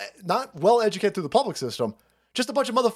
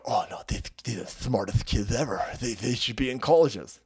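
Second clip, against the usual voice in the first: softer, lower mock-earnest voice; mocking quote; 7 semitones lower, 4 dB quieter than usual. Host mocking defenders of schools, softer and lower. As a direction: whine it like a naive defender. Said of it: "They go".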